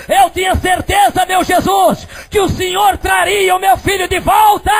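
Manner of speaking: high, loud cry to Jesus; glorifying shout. Retold or imitated. Mother praising Jesus for her returned son, high shouted cry. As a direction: shout thanks up to heaven. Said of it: "começa a glorificar e dizer".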